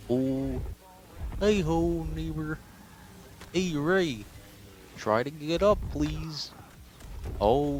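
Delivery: high-pitched puppet frog voice; Kermit. Retold or imitated. Puppet frog pleading with Rey to get up, high voice. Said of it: "I'm gonna try to do Kermit".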